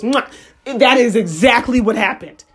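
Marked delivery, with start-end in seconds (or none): 0.0-0.3 quick mouth-made smack; imitated slap. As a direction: mimic one sudden slap with the mouth. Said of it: "all I did was".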